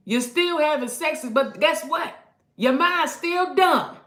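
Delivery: high, loud preaching cry; preaching shout. Real, not acted. Preacher shouting at the congregation, loud and high. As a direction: drive it home loud and high.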